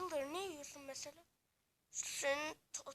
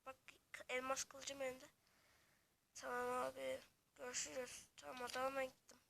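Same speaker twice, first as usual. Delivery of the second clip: ordinary delivery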